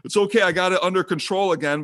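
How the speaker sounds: high, airy brush-off imitation; mock his breezy reassurance, way up high; mimicking Sean. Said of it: "and he's like".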